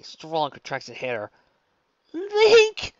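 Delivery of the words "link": desperate cry. A narrator's reading. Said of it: "she wheezed".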